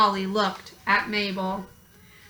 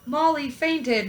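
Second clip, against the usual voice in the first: usual speaking voice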